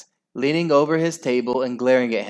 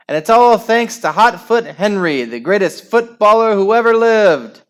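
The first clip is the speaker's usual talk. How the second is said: high, loud play-by-play building to a roar; excited commentator; 7 semitones higher, 10 dB louder than usual. Acted. Henry imagining live football commentary, frantic play-by-play. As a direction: call the winning goal like a frantic sports announcer.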